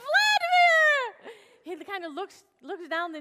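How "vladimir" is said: "Vladimir!" called out very high and loud, stretched slow; a joyful shout of recognition; loud, high, stretched greeting to the repairman at the door; delighted surprise. Retold or imitated.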